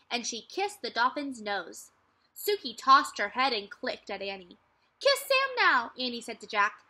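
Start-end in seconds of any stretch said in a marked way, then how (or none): 5.0-5.9 put-on high, loud little-girl voice urging; a bright kid sister egging him on; Annie's voice. A narrator's reading. Little sister teasing her brother, high and loud.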